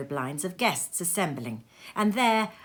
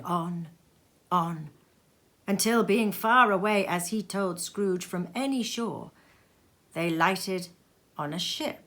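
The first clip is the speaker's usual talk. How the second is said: ordinary delivery, nothing marked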